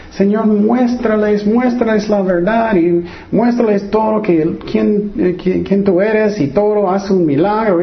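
high, imploring mock prayer; pleading prayer. Preacher imitates believers praying for family, pleading.

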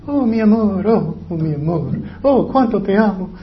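high, gushing mock sweetheart; hammed-up adoring sighs of love; mock lovey-dovey. Preacher mimics people who love only in words, gushing.

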